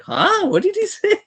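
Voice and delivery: high-pitched baffled friend; squeaky mock-puzzled reaction; mock confusion.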